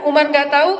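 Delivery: usual speaking voice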